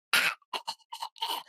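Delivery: choking gasps for air; imitated choking. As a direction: act out choking on water. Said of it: "I'm choking on the water".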